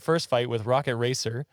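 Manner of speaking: normal talking voice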